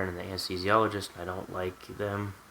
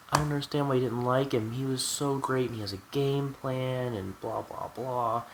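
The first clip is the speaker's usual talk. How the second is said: higher-pitched mock mom voice; imitating mom; 5 semitones higher than usual. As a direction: run on like a mimicked gushing mother. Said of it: "Mom goes".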